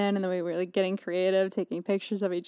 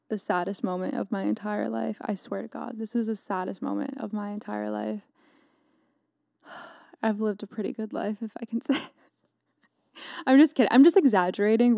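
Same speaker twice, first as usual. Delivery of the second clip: ordinary talk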